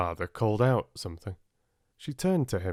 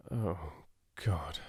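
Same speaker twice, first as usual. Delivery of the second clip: under breath; 3 semitones lower, 6 dB quieter than usual. A narrator's reading. Richard embarrassed, under breath.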